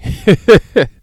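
big loud laugh; a sudden hearty laugh; loud burst of laughter. Real, not acted. Laughs at own rant.

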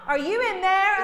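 put-on high, loud little-kid call through the door; child calling. Toddler calling through the bathroom door for mom. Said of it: "And then I hear him".

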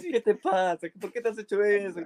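high-pitched mock scolding; imitated horrified scolding. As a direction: scold like a shocked grandmother. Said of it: "y grita".

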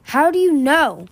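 high, loud, slow demand; forceful demand. A narrator's reading. Winter shakes Moon for answers, demanding. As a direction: press hard for an answer.

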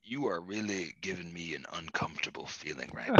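creepy breathy whisper; Joe Biden whisper. Acted. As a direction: lean in and whisper it.

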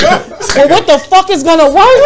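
loud, exasperated, laughing question. Retold or imitated. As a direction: laugh it out, fed up. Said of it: "And I'm like".

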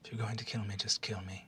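low, soft, unvoiced plea; resigned whisper. Captive whispering to her interrogators to just kill her.

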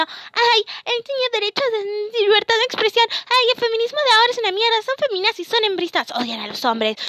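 high-pitched whining parody of the outraged; mock indignation. Podcaster mocking men outraged on behalf of a harasser, indignant. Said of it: "estarían todos súper indignados diciendo".

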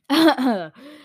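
real brief chuckle; amused laugh. Real, not acted. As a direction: laugh at your own overdone scream. Reader amused after screaming into microphone.

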